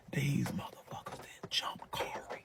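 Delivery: hushed whisper of a juicy secret; whispered aside. Real, not acted. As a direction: lean in and whisper the twist.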